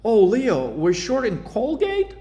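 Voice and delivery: high, clueless mock question; mock newbie asking, lightly voiced; confused trader. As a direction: ape the puzzled newbie trader. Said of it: "and they're like".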